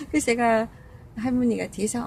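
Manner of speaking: usual speaking voice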